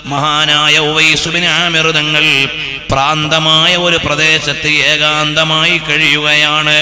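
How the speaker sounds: loud, high chanted sermon; preaching. Acted. Sermon chanted at full force.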